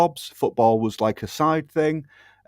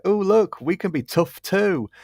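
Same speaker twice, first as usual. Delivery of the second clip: high mocking voice of preening players; mocking effeminate men; 8 semitones higher than usual. Mocking NFL players acting tough in a high put-on voice. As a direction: send up the players with a lifted, prissy tone.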